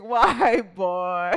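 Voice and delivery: real laughter breaking into the words; laughing. Real, not acted. Host reading a funny super chat comment, laughing through it. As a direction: say it while cracking up.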